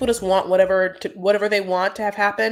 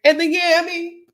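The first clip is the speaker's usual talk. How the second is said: quoting Kimberly, higher and louder; mock quote of Kimberly; 10 semitones higher, 7 dB louder than usual. Vlogger retelling Kimberly's farewell, in a higher mock voice. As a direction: toss out her line in a lightly voiced, higher Kimberly. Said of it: "And she's like".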